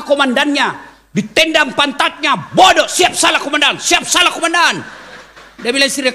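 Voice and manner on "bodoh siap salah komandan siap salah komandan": mock shouting commander. Commander scolds, husband submits.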